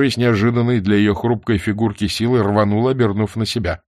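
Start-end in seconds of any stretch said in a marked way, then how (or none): none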